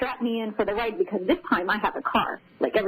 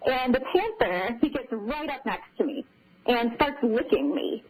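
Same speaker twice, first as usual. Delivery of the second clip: usual speaking voice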